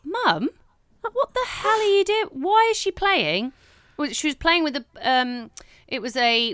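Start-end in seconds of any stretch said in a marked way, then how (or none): 0.0-3.5 high, loud shocked cry of Mum, slow at the end; shocked shout. Retold or imitated. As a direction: yell at mum in disbelief. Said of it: "And I said".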